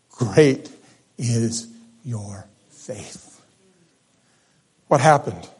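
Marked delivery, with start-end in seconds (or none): 0.1-3.3 tender praise. Acted. Jesus praising the woman.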